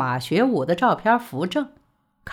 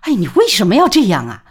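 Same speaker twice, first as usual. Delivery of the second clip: high, loud and fast, stamping with exasperation; frantic angry scolding; 13 semitones higher, 8 dB louder, faster than usual. A narrator's reading. Mother berating her son over Tsinghua, frantic loud scolding. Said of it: "李宝莉急得跳脚".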